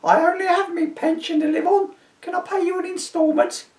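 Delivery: put-on very high pleading old woman voice; old lady pleading. A narrator's reading. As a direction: beg like a frail pensioner.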